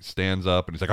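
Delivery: normal talking voice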